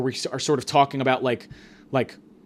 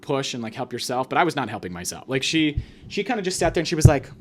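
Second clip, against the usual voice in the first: usual speaking voice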